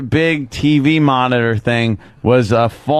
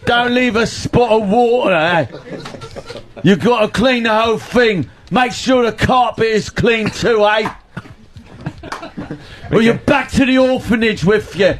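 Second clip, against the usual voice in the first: put-on hacky English accent; 8 semitones higher than usual. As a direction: shout orders like a bossy English master. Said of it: "talk to Bob in your English accent".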